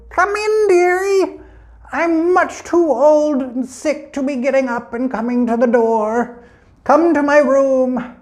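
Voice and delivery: put-on very high, creaky old granny voice; wolf as grandmother. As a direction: put on a high old-lady voice to fool the girl. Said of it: "a voice called from the back room".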